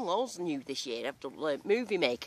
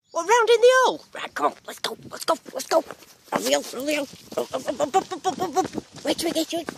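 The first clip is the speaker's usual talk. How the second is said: loud, high, eager coaxing; excited urging; 10 semitones higher, 10 dB louder than usual. Real, not acted. Digging out a find, loud excited coaxing. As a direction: cheer the find up out of the hole.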